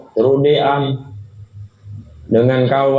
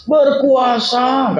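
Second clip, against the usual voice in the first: half shout; 13 semitones higher, much slower than usual. Acted. Sheikh answers drunkard again.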